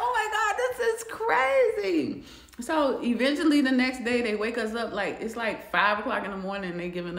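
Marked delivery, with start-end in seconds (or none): 0.0-2.2 high, slow, laughing disbelief; scandalized amazement. Retold or imitated. Whispering to herself in the bunk, high slow disbelief. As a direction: stretch out the shock with a laugh. Reacting to night noises.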